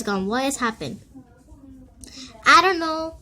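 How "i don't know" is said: higher, louder I don't know; exclaimed confusion. Real, not acted. Kid baffled the fire is gone, loud. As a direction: blurt it out, baffled.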